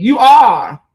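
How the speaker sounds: loud, high comeback; emphatic retort. Pretend. Retort to the wife's quoted lament.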